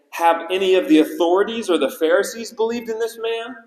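imitating a smug Pharisee; haughty mock question. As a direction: talk down as the self-made standard. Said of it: "put themselves up as the standard".

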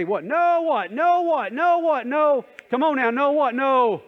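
high, loud frantic game show yelling; contestant yelling. Retold or imitated. Contestant yelling at the spinning board, high loud pleading. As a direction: shout like a desperate contestant at the board. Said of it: "they would yell".

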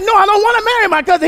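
fast, high, loud mock protest; yelling back. Son answering his dad's cousin question, yelling back. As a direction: protest loudly, acting out the exasperated son.

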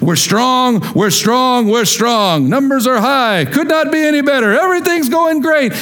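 high-pitched, emphatic sales pitch; mock upbeat company boasting; boastful pitch. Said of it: "your organization, or just you personally, has pitched".

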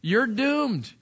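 ordinary talk, nothing marked

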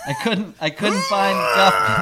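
high, loud voice breaking into laughter; hammed-up imitation of her, half laughing; put-on voice. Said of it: "I don't know why I'm doing that voice".

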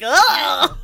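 loud high grossed-out groan; disgusted ugh. Retold or imitated. Friend reacting to her own crude joke with a loud ugh. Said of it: "I was like".

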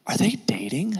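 leaning in, whispered secret question. Retold or imitated. Friend leaning in to whisper gossip, hushed whisper. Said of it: "then they whisper something to you".

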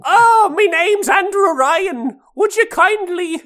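very high, loud, slow cartoon leprechaun; hammed-up stage-Irish leprechaun squeal. Co-host redoing Atlas on request as a cereal-ad leprechaun. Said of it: "like the leprechaun from the Lucky Charms commercials".

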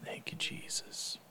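soft breathy whisper, slow; whispered thanks. Real, not acted. Leader thanks Jesus under her breath, whispered. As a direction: breathe a private thank-you.

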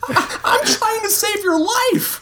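high, drawn-out strained yell; stammered start into a high desperate yell; desperate shout. A narrator's reading. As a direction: plead it in a slow, high shout. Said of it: "the doctor shouted back".

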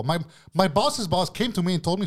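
ordinary delivery

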